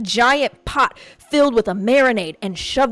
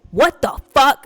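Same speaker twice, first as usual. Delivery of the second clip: louder, angry exclamation from the retold dad; pissed dad; 4 semitones higher, 5 dB louder, slower than usual. Dad reacting to the video, angry outburst. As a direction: bark it like a furious father. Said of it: "he was all pissed".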